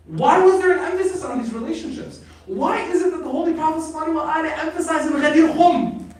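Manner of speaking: high, loud rhetorical questioning; full-force preaching. Real, not acted. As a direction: press the question hard from the pulpit.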